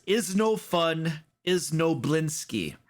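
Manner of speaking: put-on slow, heavy foreign accent on "Is no fun"; mock Eastern European accent. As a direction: read the tag like a Barovian toymaker. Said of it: "It says".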